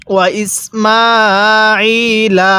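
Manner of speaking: alif drawn out long, very slow chant; measured Quranic chant; slow Quran recitation. Shown as a demonstration. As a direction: recite it slowly, stretching the long vowel. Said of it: "তাহলে কিভাবে পড়ব?".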